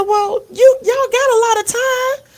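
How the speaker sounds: can't believe how much free time; incredulous. Retold or imitated. Aunt challenges niece's schedule.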